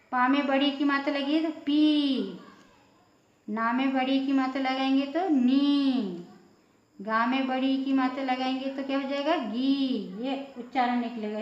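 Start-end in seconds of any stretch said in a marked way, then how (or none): 1.6-2.4 पी with the ee drawn out long. Shown as a demonstration. Teacher showing the big ee matra, long vowel. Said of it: "बड़ा आवाज़ निकलेगा". 5.3-6.2 नी with a long held ee. Shown as a demonstration. Teacher showing the big ee matra on na, long vowel. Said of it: "बड़ा आवाज़ निकलेगा". 9.4-10.2 गी with the ee drawn out; long ee. Shown as a demonstration. Teacher showing how big the sound gets, long vowel. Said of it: "इसकी आवाज़ ऐसी बड़ी होगी".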